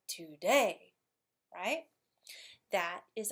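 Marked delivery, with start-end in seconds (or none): 0.1-0.9 today with a loud, swelling DAY; stress on DAY. Shown as a demonstration. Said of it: "That's how it's coming up".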